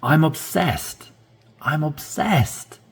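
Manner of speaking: I'm hit high and slow, drawn out; stress on I'm. Shown as a demonstration. Teacher showing learners where to stress, slow and emphatic. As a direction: lean hard on I'm, slowly. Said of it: "Really, really wanna stress in here".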